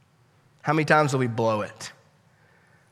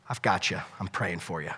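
soft, low, intimate promise; tender Jesus; 8 semitones lower, 9 dB quieter than usual. Acted. Jesus promises Peter his prayer, soft and low. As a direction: hush it like a promise to a friend.

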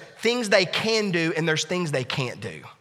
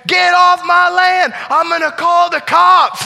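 yell; 11 semitones higher, 9 dB louder, slower than usual. Retold or imitated. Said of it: "comes again, same".

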